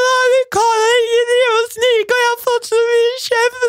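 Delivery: high, slow sobbing voice; imitated wailing. Telling Herman they can't cut in line, high slow sobbing. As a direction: sob it out, pitch up high. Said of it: "og jeg hylgråt".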